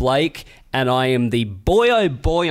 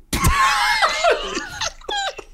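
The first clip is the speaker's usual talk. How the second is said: high shrieking burst of laughter; big shrieking laugh. Real, not acted. Friend boasts he's richest, then shrieking laugh. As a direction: crack up at the joke.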